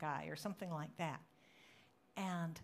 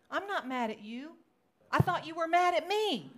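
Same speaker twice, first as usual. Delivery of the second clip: loud, high retort, acted out; hammed-up surprise, rising in volume; protesting denial; 9 semitones higher, 10 dB louder than usual. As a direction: retell an astonished protest. Acts out friend's surprised denial.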